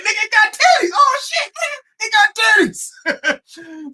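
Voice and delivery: high disbelieving yell; freaked-out shout. Retold or imitated. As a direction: scream it like you just saw a monster. Retold friend freaking out.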